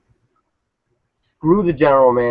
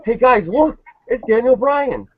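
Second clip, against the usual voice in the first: much higher, slower, hammed-up goofy commentator; mock Jerry Lawler; 12 semitones higher, slower than usual. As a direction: squeak it out like a clueless announcer. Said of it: "Jerry Lawler's like".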